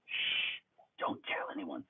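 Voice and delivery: hushed conspiratorial whisper; a pretend secret whispered; mock whisper. Conspiratorial aside about a secret.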